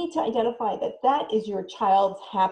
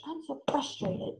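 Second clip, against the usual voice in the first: mild frustration; 5 semitones higher, 6 dB quieter than usual. Shown as a demonstration. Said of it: "identifying that feeling".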